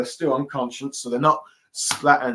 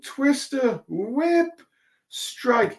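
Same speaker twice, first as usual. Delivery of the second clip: much higher called-out move names; signature move calls; 11 semitones higher, much slower than usual. Retold or imitated. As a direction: announce each strike like an anime hero. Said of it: "each saying".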